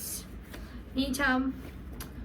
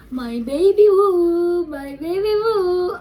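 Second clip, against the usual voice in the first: loud, slow, high singsong; drawn-out, higher, adoring whoop; joyful crowing; 6 semitones higher, 9 dB louder, slower than usual. Real, not acted. Cooing at the new perfume.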